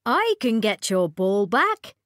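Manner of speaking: lightly voiced friendly frog; a hint of a croaky frog. A narrator's reading. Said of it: "the frog said".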